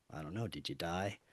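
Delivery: very low, hushed imitation of a friend; barely audible, deadpan retold reply; eerily detached. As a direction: answer very softly and low, giving nothing away. Eerie changed friend answers the panicking teller.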